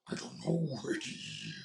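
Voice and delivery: soft, breath-heavy monster impression; Venom. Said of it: "it sounds more".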